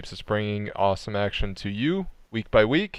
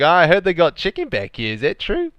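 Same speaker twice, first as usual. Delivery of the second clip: high, raised goofy voice; voicing the driver; 7 semitones higher, 5 dB louder than usual. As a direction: play the curious driver looking for chicken. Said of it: "he's like".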